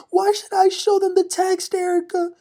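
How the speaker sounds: high, loud mock-crying protest; Kyle Richards. Kyle cries at Erica who came after her, mock-crying. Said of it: "now she's crying".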